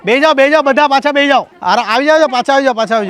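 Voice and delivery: high, loud call to sit back down. Real, not acted. Host calling the children back to sit, loud call. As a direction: shout them back to their places.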